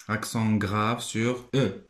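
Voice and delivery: ordinary talk, nothing marked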